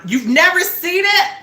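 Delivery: high, loud incredulous squeal; can't believe they haven't seen it; shocked disbelief. Real, not acted. Shocked friend hasn't seen movie.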